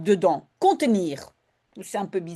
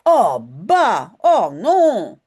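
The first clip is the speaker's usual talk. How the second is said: dismay; 6 dB louder, much slower than usual. A narrator's reading. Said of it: "et elle dit".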